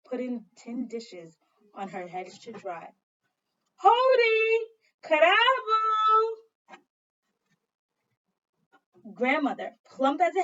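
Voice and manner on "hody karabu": high, loud called-out hello, drawn slow; loud greeting. Acted. Child calling out a greeting to grandmother, loud and drawn out. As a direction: call out a greeting across the yard.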